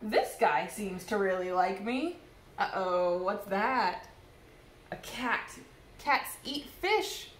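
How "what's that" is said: ordinary delivery, nothing marked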